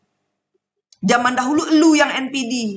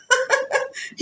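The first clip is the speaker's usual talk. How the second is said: a sudden high burst of laughter; 11 semitones higher, 4 dB quieter than usual. Real, not acted. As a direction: laugh out, delighted. Laughing after quoting God. (tawa tinggi yang tiba-tiba)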